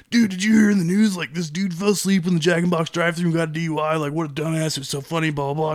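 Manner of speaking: lightly voiced gossipy friend; imitating German. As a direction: mimic friend gossiping eagerly. Quoting German mocking the news.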